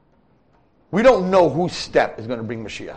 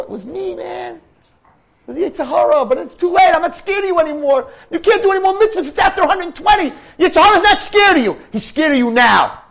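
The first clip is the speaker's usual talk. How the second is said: much higher and louder, mocking the dead; gloating Yetzer Hara; 6 dB louder than usual. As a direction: cackle over a soul too late to fight. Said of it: "He's gonna be saying".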